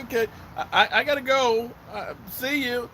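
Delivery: high, halting mock goodbye; awkward getaway. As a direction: stammer an excuse to leave, high-pitched. Acts out escaping the chatty locals.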